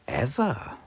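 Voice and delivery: ordinary delivery, nothing marked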